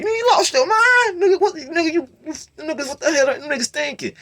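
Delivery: high, loud outraged yell; incredulous shouting. Retold or imitated. Brother yelling at his little brother, outraged. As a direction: rage at their foolishness. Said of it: "he looking at me like".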